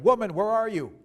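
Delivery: high, booming call; loud demand. A narrator's reading. Husband slams door and calls wife, loud demand. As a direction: shout for her as you burst in. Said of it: "spoke a little louder".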